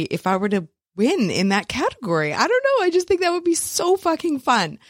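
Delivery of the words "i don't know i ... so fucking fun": giddy excitement. Real, not acted. Excited about winning award.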